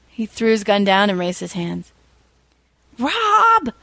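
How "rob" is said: much higher sharp call of the name; mock hiss. A narrator's reading. Third criminal warning his partner, high and sharp. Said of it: "the third criminal hissed".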